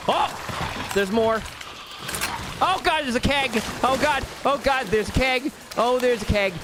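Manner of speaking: high startled panic; panicked. Real, not acted. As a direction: yelp it out in alarm. Panic at the keg.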